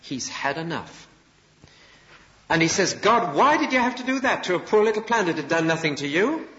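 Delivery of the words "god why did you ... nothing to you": high, indignant cry to God; outraged prophet scolding God; angry protest. Acted. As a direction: plead hotly for the poor plant. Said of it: "And he's angry".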